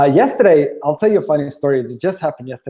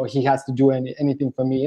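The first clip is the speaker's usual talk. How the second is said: normal talking voice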